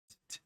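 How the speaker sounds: soft clicking t sounds; tongue-tip T. Shown as a demonstration. Teacher demonstrating tongue placement for T. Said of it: "instead of going t".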